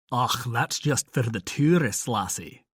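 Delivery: a lightly voiced heavy Scottish brogue, teasing; hint of a thick Scottish brogue. A narrator's reading. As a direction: lay on the Scottish accent a bit for fun. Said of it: "he said in his heaviest brogue".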